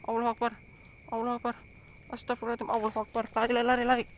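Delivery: high, frantic panicked cries. Real, not acted. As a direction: shriek it in sudden terror. Sees fire, panics and runs.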